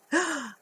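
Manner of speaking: delighted pretend gasp; mock gasp. Reader gasping as she shows the page to children. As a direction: gasp at the picture.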